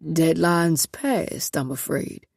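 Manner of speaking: slow, low, smug amusement; smile in voice. Acted. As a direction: savor the refusal with a smile. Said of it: "with a smile in his voice".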